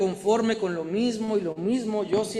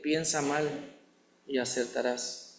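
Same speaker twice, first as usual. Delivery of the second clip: softer and lower, trailing off; soft quoted lie; 5 semitones lower, 7 dB quieter than usual. Retold or imitated. Preacher quoting the lie people believe, softly. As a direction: let the lie fade away quietly. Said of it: "hemos creído en la mentira de".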